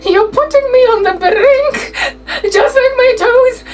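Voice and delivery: high, whiny, about to cry; mock the teacher's quivering complaint; teary teacher voice. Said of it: "One day she was like".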